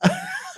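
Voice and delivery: short high burst of laughter; high squeaky laugh; real laugh. Real, not acted. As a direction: laugh out at yourself. Laughing at own swollen tongue.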